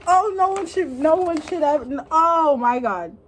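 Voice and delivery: ordinary delivery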